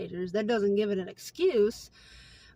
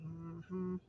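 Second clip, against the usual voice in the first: sarcastic mm-hmm; 4 semitones lower, 13 dB quieter than usual. Retold or imitated. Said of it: "I'm thinking to myself".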